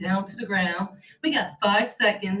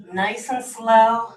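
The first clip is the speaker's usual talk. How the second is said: slow coaching cue; 8 dB louder, slower than usual. Real, not acted. Coach pacing the class.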